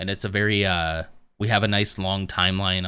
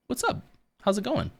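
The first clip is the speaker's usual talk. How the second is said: excited greeting; 13 semitones higher, 4 dB quieter than usual. Real, not acted. Greeting a familiar chatter.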